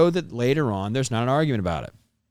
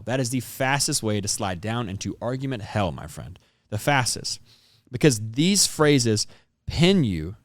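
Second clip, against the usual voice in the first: ordinary talk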